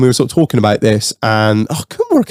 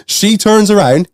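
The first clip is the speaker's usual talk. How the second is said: ordinary talk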